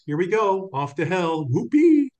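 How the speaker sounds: sarcastic singsong whoopee, leaping high and loud; mock cheer. Quoting a saying with a mock-gleeful whoopee. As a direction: cheer sarcastically about heading off to hell. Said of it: "I just said whoopee".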